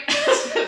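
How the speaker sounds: quick high-pitched laugh. Real, not acted. Telling viewers about the Austin trip, high laugh. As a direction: let out a short, high laugh.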